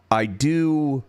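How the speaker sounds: ordinary talk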